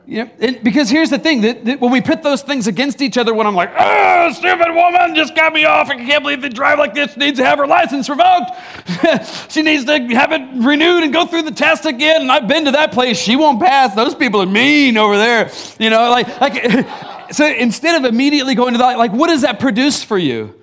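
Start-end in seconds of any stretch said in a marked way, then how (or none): 3.8-15.5 hammed-up road rage; mock angry driver. Preacher mocking himself yelling at another driver.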